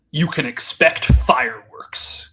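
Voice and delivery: slow, loud wrestling promo opener; mock wrestling promo, drawn out and punched. Co-host setting up a joke about what fans can expect, mock wrestling promo. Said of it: "wrestling promo voice here".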